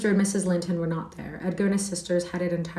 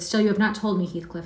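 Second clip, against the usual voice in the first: plain reading voice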